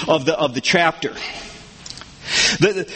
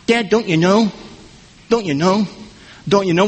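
lightly voiced daughter. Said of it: "my daughter Kelsey's famous question".

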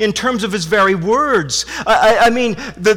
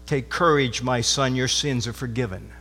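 soft and much lower, a gentle pardon; tender forgiveness; 8 semitones lower, 8 dB quieter than usual. Retold or imitated. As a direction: speak it softly as a tender reassurance to a son. Jesus forgiving a sinner, quoted.

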